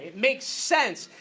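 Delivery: "It makes sense" slowed right down, "makes" leaned on; emphatic, slow. Real, not acted. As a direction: spell out "It makes sense" word by word. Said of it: "That's a very important thing".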